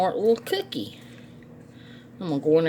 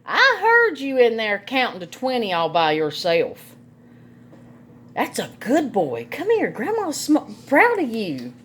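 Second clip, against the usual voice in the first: doting praise; 6 semitones higher than usual. Real, not acted. Grandma praising her grandson for counting, high and doting. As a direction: coo over him proudly.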